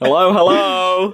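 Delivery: slow hollow call into a cave; echoing hello. Pretend. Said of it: "Like you're shouting into a cave".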